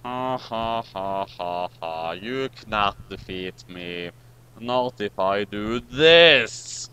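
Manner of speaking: slow, low evil cackle and threat; villain laugh. Acted. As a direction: gloat slowly like a cartoon villain. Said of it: "the master said".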